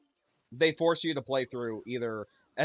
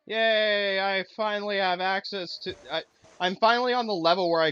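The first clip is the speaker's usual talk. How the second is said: loud, high cheer; raised, delighted yay; excited celebration; 8 semitones higher, 6 dB louder than usual. Real, not acted. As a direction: celebrate a long-awaited unlock. Celebrates unlocking game access.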